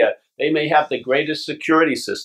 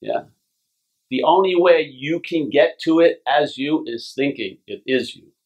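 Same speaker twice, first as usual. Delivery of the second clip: ordinary talk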